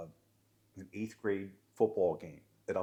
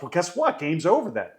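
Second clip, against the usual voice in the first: louder, higher, furious ultimatum; livid ref; 7 semitones higher, 8 dB louder than usual. Retold or imitated. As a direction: slam the door on him. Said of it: "I was absolutely livid".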